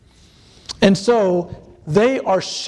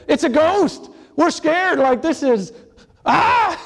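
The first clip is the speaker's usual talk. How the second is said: high, rising panic ending in a yelp; hammed-up terror at a ghost; panicked disciples; 8 semitones higher than usual. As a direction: spiral into fright, then cry out. Acting out the terrified disciples.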